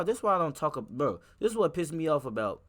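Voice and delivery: normal talking voice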